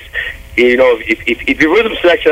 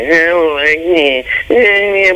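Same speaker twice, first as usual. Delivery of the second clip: high mock whine; whining imitation; 5 semitones higher, much slower than usual. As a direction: imitate someone complaining. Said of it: "whine or complain".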